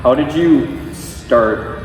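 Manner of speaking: ordinary talk, nothing marked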